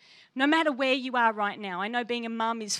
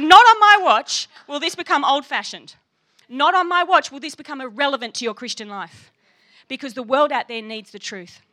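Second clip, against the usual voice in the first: much louder and higher, a ringing vow; passionate declaration; 3 semitones higher, 13 dB louder than usual. Retold or imitated. To the Bible Society woman, a loud passionate vow. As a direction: preach it like a battle cry. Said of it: "Whoa, you're so passionate".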